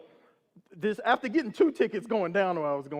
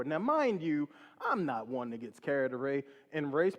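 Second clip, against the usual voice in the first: plain reading voice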